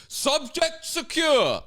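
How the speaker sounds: high, drawn-out mocking announcement; exaggerated police call; mock officer call-out. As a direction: call it out slowly like a hammy policeman. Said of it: "see the way the guy goes".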